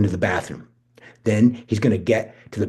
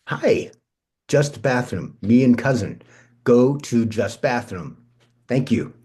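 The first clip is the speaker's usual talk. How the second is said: fake broken English; 2 semitones higher, slower than usual. [name] fools the conductor in halting foreign English. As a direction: play dumb tourist to dodge the ticket check.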